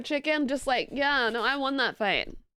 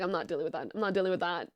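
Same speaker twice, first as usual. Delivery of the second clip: low, softer brush-off; muttered dismissal; 9 semitones lower, 5 dB quieter, much faster than usual. Retold or imitated. Streamer retelling how she gave up arguing, dismissive. Retold dismissal after the outburst.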